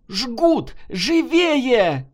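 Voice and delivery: high, urgent command; emergency shout from an army doctor; shouted order. A narrator's reading. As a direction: yell it at the orderlies. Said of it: "крикнула санитарам военврач".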